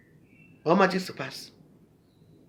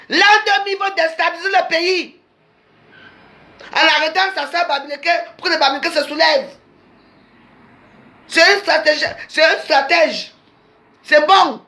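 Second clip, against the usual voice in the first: raised, higher, accusing tirade; heated rant; 8 semitones higher than usual. Real, not acted. Denounces strategy, high pitch. (diatribe enflammée contre l'adversaire)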